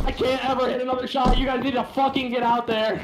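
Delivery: high-pitched, exasperated rant at teammates; frustrated outburst. Real, not acted. Player berating teammates after missed shots, high-pitched frustration. Heated complaint to teammates in game.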